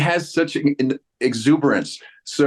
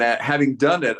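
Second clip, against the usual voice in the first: ordinary talk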